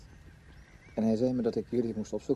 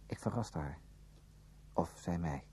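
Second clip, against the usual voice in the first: whispered narration; 5 semitones lower, 9 dB quieter, slower than usual. A narrator's reading.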